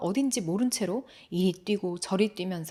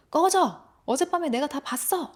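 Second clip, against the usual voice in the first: high, loud outburst; angry shouting; 7 semitones higher, 4 dB louder than usual. Acted. Boy yelling through the bathroom door at his brother, shouting. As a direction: shout it out to drive him away. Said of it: "라고 막 소리쳐요".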